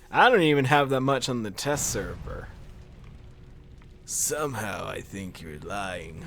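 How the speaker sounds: normal talking voice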